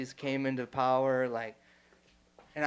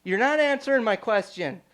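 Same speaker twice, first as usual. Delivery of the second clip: raised, louder retort; retold snap back; 7 semitones higher, 7 dB louder than usual. Retelling his reply to the yelling man, raised and louder. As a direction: throw it back at him, voice up. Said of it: "And I'm like".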